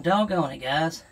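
ordinary delivery

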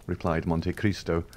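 ordinary delivery, nothing marked

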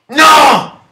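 sharp loud high no; shout. Real, not acted. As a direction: yell it in frustration. Dies in game, shouts. (urlo di frustrazione)

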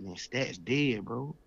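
usual speaking voice

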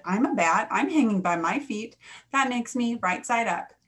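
loud insisting. A narrator's reading. Bat insists.

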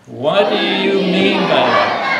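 loud and slow; yell it. Shown as a demonstration. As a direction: shout it out together. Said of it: "one more time".

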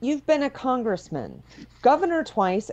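ordinary talk, nothing marked

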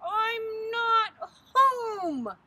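long wailing moan, high and drawn out; loud moan. A narrator's reading. Sister turns away friends at the doorbell, moaning. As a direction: moan it loudly, stretching every word. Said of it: "she moaned".

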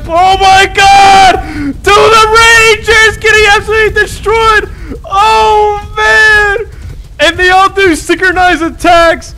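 shrieking in alarm, very high and loud; excited shouting. Real, not acted. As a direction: yell in panic as the towers fall. Boss wave overwhelms towers.

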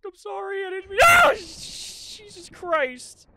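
high, loud shriek of fright; terrified scream. Real, not acted. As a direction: yell as the body lunges. Corpse jumps, streamer screams.

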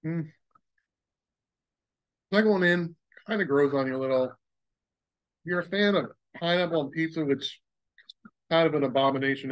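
speaking between bites; chewing. Real, not acted. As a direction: mouth partly full. Seen 0: Chip eaten at -7; jaw working, lips closed while he speaks.

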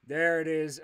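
usual speaking voice

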